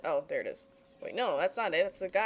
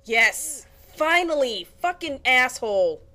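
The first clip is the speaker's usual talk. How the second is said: louder cheer of victory that tips into an insult; triumphant shout; 3 semitones higher, 8 dB louder, slower than usual. Real, not acted. Gamer yelling at the enemy he finally beat. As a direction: yell it out, finally winning.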